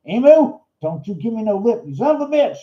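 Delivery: hammed-up scolding of a pecking hand-puppet bird; mock angry yell.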